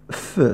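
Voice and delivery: ف as a lone, voiceless f hiss; French f sound. Shown as a demonstration. Teacher showing the f sound alone: bare hissed ف. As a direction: breathe out ف with no vowel. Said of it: "حرف إف سأنطقه".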